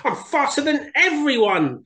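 high, slow, loud brag; boasting Hare shout. Acted. Hare boasting to the animals, high loud shout. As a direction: crow about being fastest. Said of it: "boasted Hare".

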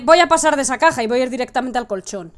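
ordinary delivery

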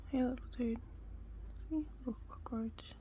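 hushed whisper to a tiny bug; soft whisper. Real, not acted. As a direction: whisper so you don't scare the cockroach off.

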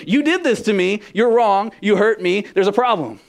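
mock a hurt spouse lashing out; pointed accusation; accusing.

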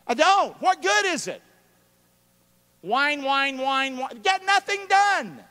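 high, loud mock whine that slows at the end; mocking whiners. Pastor mocking whiners with a high, slow whine. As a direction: whine it like a complainer.

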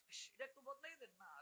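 very soft, quick mock request; distracted haggling. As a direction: ask a vendor for a swap under your breath. Mimics chanter haggling between mantras. (দোকানিকে মৃদু, দ্রুত অনুরোধের অভিনয়)

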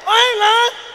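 ordinary delivery

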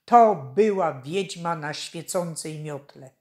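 ordinary delivery, nothing marked

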